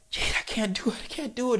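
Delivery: high, whispered plea in labor pain; desperate whisper. Retold or imitated. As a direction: beg in a high whisper that you can't go on. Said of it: "She's like".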